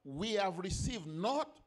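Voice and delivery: loud, ringing scripture proclamation; forceful preaching. Real, not acted. Preacher reading Corinthians on the spirit from God, forceful preaching. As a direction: proclaim the verse in weighty phrases.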